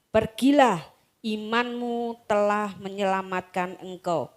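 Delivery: slow, solemn Bible reading; measured sermon reading voice; scripture reading. Acted. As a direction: read the Gospel to the congregation. Bible passage read aloud.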